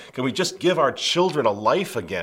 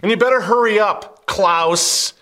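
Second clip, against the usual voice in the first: loud, raised-pitch derisive tirade; scornful rant; 6 semitones higher, 5 dB louder than usual. Real, not acted. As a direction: taunt him loudly, pitch pushed high. Mocking rant at Klaus Schwab.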